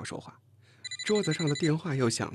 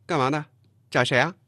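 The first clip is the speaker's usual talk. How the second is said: loud, higher-pitched challenge at the door; huffy; 4 semitones higher, 6 dB louder than usual. A narrator's reading. Chen Jing blocking the girl at the door, huffy. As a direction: question the visitor crossly. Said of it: "声音气鼓鼓的".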